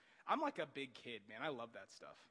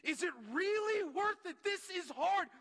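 much higher and louder, straining; anguished plea; 9 dB louder, slower than usual. Real, not acted. Struggling believer voicing despair to God, anguished. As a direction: cry out the doubt. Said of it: "asked yourself that question".

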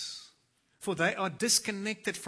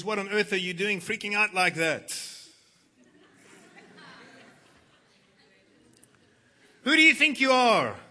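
loud, high scolding; stern rebuke; 4 semitones higher, 10 dB louder than usual. Acted. God scolding Moses for freaking out, loud and angry. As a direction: bark the rebuke like an angry boss. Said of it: "Then the Lord said to Moses".

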